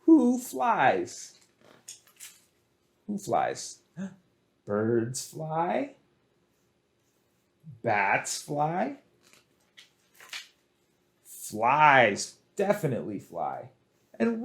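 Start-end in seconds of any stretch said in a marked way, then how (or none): none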